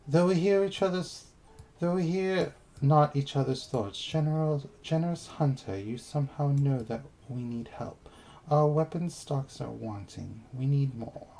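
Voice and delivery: hammed-up British quest voice, giggling into it; British accent. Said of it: "I don't know why I'm giving it a British accent".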